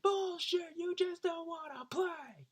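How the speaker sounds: high, slow mock heckle; Brian heckling. Brian heckles the jocks over the delay, high slow mock voice. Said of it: "he was like".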